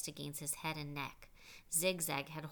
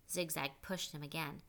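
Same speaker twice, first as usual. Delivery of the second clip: plain reading voice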